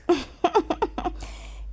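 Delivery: quick amused laugh; high breathy laugh. Real, not acted. Storyteller laughing at Phinda's mess.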